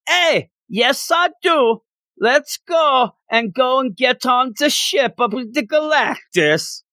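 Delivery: high, slow, hammed-up Canadian accent; cartoon Canadian, drawn out; mock Canadian accent. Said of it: "That's how I think everybody in Canada talks".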